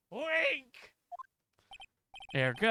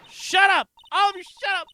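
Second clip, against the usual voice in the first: high, loud screaming outburst; furious shout; 11 semitones higher, 10 dB louder than usual. Acted. Witness losing it at everyone in court, furious scream. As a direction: yell at the whole courtroom.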